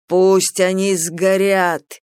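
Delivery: drawn-out burning command; bright soaring fiery voice. Acted. Phoenix urges burning.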